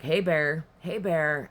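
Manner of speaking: loud, high call of hey bear to scare it off. Retold or imitated. Calling out loudly to the growling thing in the bush. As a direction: shout it out to warn an unseen animal away.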